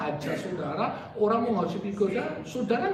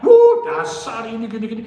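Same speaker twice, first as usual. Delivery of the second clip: loud mock scolding, half sung; grumbling like an ungrateful tenant; sing-song mock complaint; mock angry grumble; 12 dB louder than usual. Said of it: "Bukan marah-marah".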